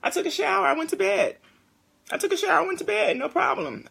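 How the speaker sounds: put-on high child voice reporting bedtime; child's voice. Mother imitating her son's bedtime report, high child voice. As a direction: imitate a small kid, matter-of-fact. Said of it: "my baby was like".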